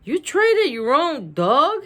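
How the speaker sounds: stunned friend asking; shocked. A narrator's reading. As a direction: gasp it out in disbelief. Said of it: "Schroeder is shocked".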